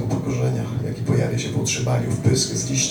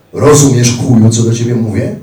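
much louder, higher shouted question; loud threatening militant yell; angry shout; 8 semitones higher, 11 dB louder than usual. Retold or imitated. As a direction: bark it at him.

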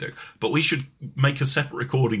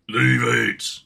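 Cockney bloke; 7 dB louder, much slower than usual. Pretend. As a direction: bark "Leave it." like a furious East End man. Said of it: "it'd be like".

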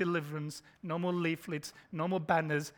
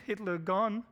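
ordinary talk, nothing marked

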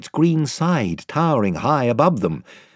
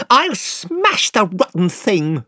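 slow, higher angry outburst; boy raging at the magic picture, crying out; 7 semitones higher, slower than usual. Acted. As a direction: spit it out like a furious boy. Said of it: "cried Eustace".

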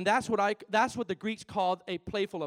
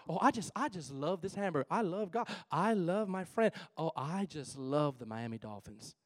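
soft swooning love for everything; mock gushing; 4 semitones lower, 8 dB quieter than usual. Preacher mimicking how people gush about anything, soft. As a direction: sigh it out like a smitten fan. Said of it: "that's how we talk".

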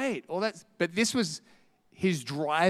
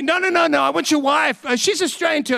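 higher, louder, pushy and eager; eager pushy man; 10 semitones higher, 6 dB louder than usual. Retold or imitated. Storyteller mimics a pushy man at dinner, eager and higher. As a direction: mimic the pushy guy cutting in. Man pushing past to talk to wife.

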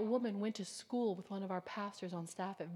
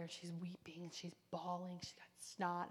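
hushed whisper; 4 semitones lower, 11 dB quieter than usual. Real, not acted. Hushed prison retelling.

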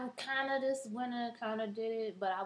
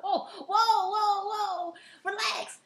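mock calming cop; 9 semitones higher, much slower than usual. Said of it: "They be like".

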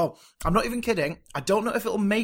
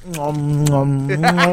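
chewing while talking; eating chocolate; 10 dB louder, much slower than usual. Real, not acted. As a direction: mouth full of chocolate. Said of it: "just put chocolate in my mouth".

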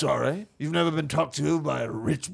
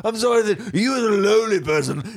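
haughty, affected rich-man voice; pompous upper-crust scolding; rich person's talk; 3 semitones higher than usual. Acted. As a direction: look down on a lowly person. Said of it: "This is how a rich person talks".

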